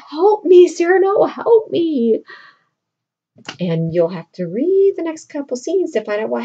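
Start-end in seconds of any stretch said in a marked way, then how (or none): 0.1-2.2 loud, high cry for help; desperate plea. Retold or imitated. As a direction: beg your friend to save you.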